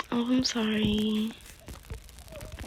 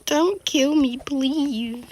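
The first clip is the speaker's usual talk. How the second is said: high, louder plea; pleading; 7 semitones higher, 4 dB louder, slower than usual. Real, not acted. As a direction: beg for your life in a raised pitch. Pleading as game threat nears.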